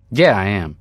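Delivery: sharp, loud snap of a reply; too sharp and loud. Acted. Spacer snaps back at the woman, sharp and loud. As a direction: bite the words off, too loudly. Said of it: "I said it too sharply, too loudly".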